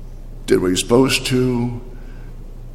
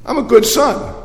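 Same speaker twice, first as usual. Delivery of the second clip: higher, indignant protest; resentful older son; 6 semitones higher than usual. Acted. Older son complaining to his father about his brother, indignant and higher. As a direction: list every grievance with rising bitterness. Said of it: "And the son said".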